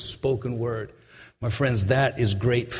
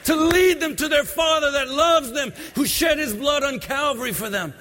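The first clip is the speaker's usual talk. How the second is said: high, sung-out preaching over music; preaching at full force; 10 semitones higher than usual. Acted. Preacher singing out to the congregation, full force. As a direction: lift it into a chanting preacher's cry.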